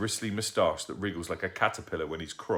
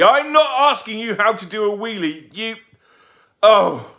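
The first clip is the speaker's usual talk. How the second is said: exasperated yell; 14 semitones higher, 13 dB louder than usual. Acted. Mum exasperated yelling.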